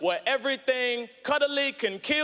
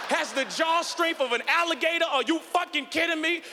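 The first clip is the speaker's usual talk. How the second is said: higher, disbelieving echo; incredulous; 5 semitones higher than usual. Acted. Comedian answering zookeeper's alligator claim, higher and incredulous. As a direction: throw the fact back in comic outrage.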